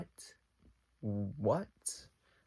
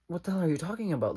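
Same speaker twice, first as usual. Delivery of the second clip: fast, louder, much higher burst of disbelief; baffled outburst; 7 semitones higher, 7 dB louder, much faster than usual. Retold or imitated. Retelling her baffled reaction to him, a fast high outburst. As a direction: blurt it out fast, high and loud.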